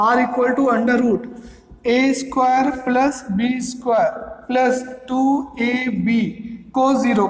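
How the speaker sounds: drawn out slowly word by word; slow dictation. Shown as a demonstration. Teacher writing formula for class, slow dictation. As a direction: read it slowly as you write.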